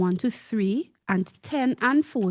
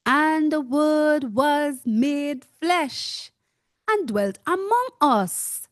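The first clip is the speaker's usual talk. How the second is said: solemn scripture reading; 5 semitones higher, slower than usual. Acted. Preacher reads key verse.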